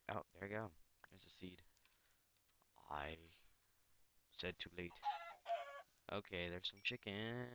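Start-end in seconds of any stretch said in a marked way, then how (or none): none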